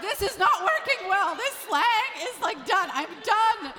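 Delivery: loud, high, comic giving up; mock exasperation. Mom giving up on explaining slang, mock exasperated.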